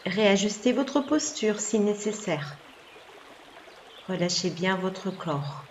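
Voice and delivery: ordinary talk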